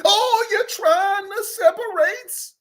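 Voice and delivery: high, loud, slow mock outrage; mocking outraged voice. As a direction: ham up the offended accuser. Mocking voice of other side.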